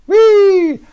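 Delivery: high loud whee; imitated whee. Dad voicing wife's leap, high loud squeal. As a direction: let out the wife's squeal as she drops. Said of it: "she goes".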